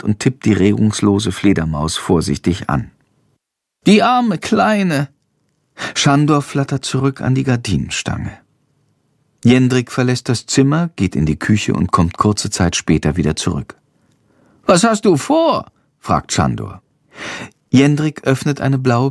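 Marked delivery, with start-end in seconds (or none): 3.9-5.1 high, very slow, grieving bat voice; sorrowful little bat. A narrator's reading. Shandor mourns the dead little bat, high and slow. 14.7-15.7 high little bat voice asking; a small creature wondering aloud; curious little bat. A narrator's reading. Shandor asks Jendrik what he plans, high and curious.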